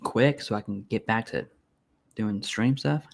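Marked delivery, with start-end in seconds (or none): none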